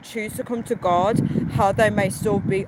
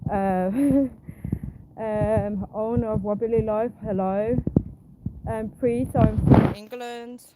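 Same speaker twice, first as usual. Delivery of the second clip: normal talking voice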